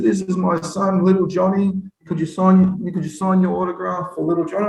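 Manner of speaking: eager fan. Retold or imitated. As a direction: ask sweetly for the autograph.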